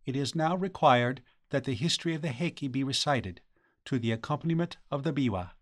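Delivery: soft, formal matron; woman's voice. A narrator's reading. Matron addressing the blind musician, softly formal. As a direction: speak softly as a courtly matron. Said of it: "the voice of a woman".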